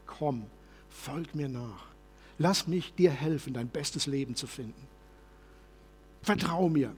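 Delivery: soft, low and tender invitation; gentle voice. Shown as a demonstration. Pastor voicing Jesus's gentle call, soft and tender. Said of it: "Jesus sagt dir das mit einer sanften Stimme".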